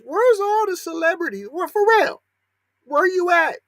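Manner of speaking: high-pitched, incredulous ranting questions; indignant demand. Real, not acted. Commentator demanding police and marchers show up, indignant.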